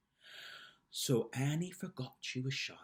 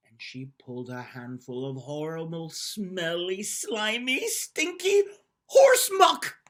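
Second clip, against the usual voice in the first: building disgust; 3 semitones higher, 9 dB louder, slower than usual. A narrator's reading. Storyteller building to the gross reveal, rising to loud disgust.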